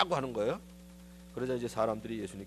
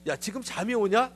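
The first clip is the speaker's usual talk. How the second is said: higher, louder rebuke; harsh scolding; 9 semitones higher, 7 dB louder than usual. Retold or imitated. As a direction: snap at the sleeping disciples. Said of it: "예수님이 꼭 그러신 것 같아요". (높고 크게 꾸짖는 말투)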